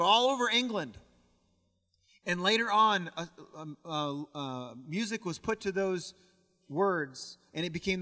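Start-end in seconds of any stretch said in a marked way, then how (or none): none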